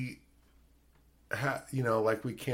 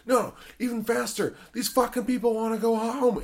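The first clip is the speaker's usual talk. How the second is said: put-on high, louder yelling at the kids; shouted direction; 10 semitones higher, 4 dB louder than usual. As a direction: bark the order to run. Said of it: "he's like".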